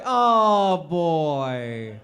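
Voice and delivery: put-on drawn-out letdown Oh boy, sagging at the end; mock disappointment. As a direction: act let down by the news.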